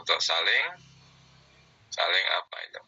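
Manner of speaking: ordinary talk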